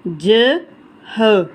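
ordinary delivery

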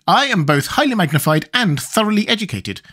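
high, earnest, learned creature; earnest Wogglebug. A narrator's reading. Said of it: "said the Wogglebug earnestly".

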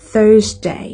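"Thursday" as THURZ-day; "Thursday" stressed on the first syllable; English Thursday. Shown as a demonstration. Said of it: "ก็บ่แม่น Thursday".

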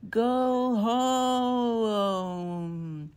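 loud, high shriek of wind. A narrator's reading. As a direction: shriek like a storm. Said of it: "it shrieked".

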